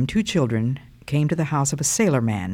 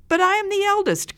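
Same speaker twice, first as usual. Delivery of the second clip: put-on very high, indignant child; protesting cry. A narrator's reading. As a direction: object like an outraged eldest child. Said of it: "cried the first child".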